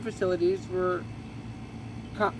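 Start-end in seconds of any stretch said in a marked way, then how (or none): none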